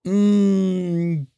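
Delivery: ordinary talk, nothing marked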